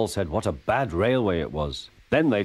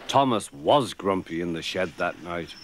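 usual speaking voice

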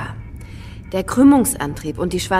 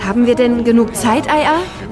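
anxious raised voice; 4 semitones higher, 6 dB louder than usual. Acted. Fleeing in panic.

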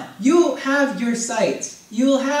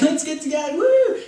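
high whooping, laughing mock enthusiasm; hammed-up cheer; 6 semitones higher than usual. Preacher mocking a showy churchgoer, high whooping cheer. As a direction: cheer like an eager youth leader. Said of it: "you know, like".

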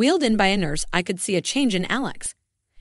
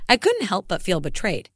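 ordinary delivery

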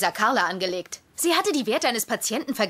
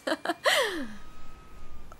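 soft laugh; 8 semitones higher, 6 dB quieter than usual. Acted. Laughs at her own joke.

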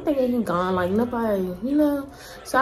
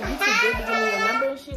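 fast, much higher, louder mock complaint; imitating an irritated guy; 11 semitones higher, 5 dB louder, faster than usual. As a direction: rattle it off like a pushy man who got refused. Quoting the pushy guy.

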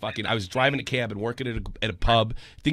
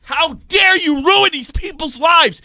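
much higher and louder, yelling at the commentary community; furious outburst; 12 semitones higher, 5 dB louder than usual. Real, not acted.

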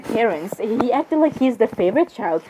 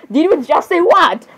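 normal talking voice

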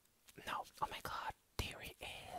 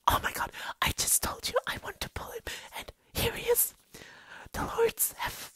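breaking out of the whisper, much louder; loud excitement; 14 dB louder than usual. Real, not acted. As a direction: burst out overjoyed, barely containing it. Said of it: "I'm maybe a little bit loud".

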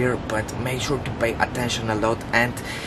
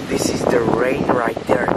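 ordinary delivery

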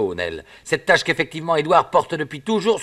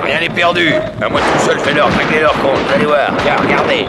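loud confident calling out; bold shout; 6 dB louder than usual. Acted. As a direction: shout over the gunfire. Tire sur les Indiens. (cri assuré)

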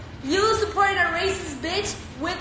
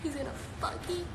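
hushed worry; 12 dB quieter than usual. Real, not acted. Worried aside, much softer.

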